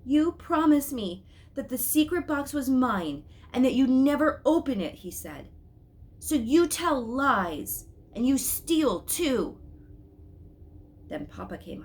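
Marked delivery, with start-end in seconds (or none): 0.0-5.0 put-on furious boy. A narrator's reading. Boy accusing Mama of opening his box, furious. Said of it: "He was furious". 6.2-9.6 louder, higher, very slow and accusing; slow furious accusation. A narrator's reading. Angry boy accusing his mother, slow and furious. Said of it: "He was furious".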